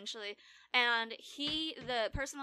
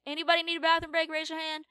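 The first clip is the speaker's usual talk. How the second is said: raised, higher floor-staff call; 6 semitones higher, 5 dB louder than usual. Retold or imitated. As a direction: imitate staff calling across a room. Imitates floor staff calling out.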